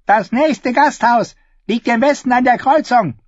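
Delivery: high, sharp voice of a gruff peasant woman; a suspicious woman shooing strangers off; hostile woman. Acted. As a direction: turn them away coldly from the window. Hostile woman refuses travellers.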